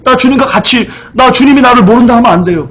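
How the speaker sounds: high, urgent imitation of a startled disciple; acted-out alarmed begging; startled plea. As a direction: plead as if about to be disowned. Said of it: "놀라서".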